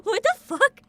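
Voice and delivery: loud laughing disbelief. Real, not acted. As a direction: laugh out the question, incredulous.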